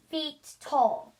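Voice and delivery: ordinary delivery, nothing marked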